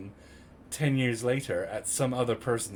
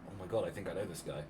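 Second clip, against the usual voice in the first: low, hushed aside to oneself; quiet, lowered thinking-aloud quote; retold inner thought; 5 semitones lower, 8 dB quieter, faster than usual. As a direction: voice a private dawning suspicion softly. Retold private thought of recognition.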